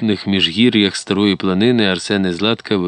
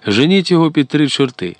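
higher-pitched cry from angry Cossacks; mock crowd shout; 7 semitones higher, 3 dB louder than usual. A narrator's reading. Cossacks driving a man away, mild narrated shout. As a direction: call it out as a narrated Cossack yell. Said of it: "закричали козаки".

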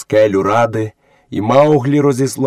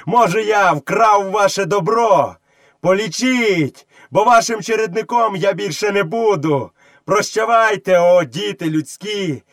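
high, stretched-out taunting call; scornful shout; 8 semitones higher than usual. A narrator's reading. Boy bidding villagers a contemptuous farewell, scornful shout. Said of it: "зневажливо закричав Мауглі".

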